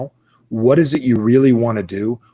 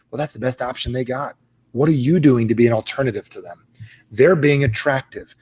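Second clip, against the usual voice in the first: ordinary talk, nothing marked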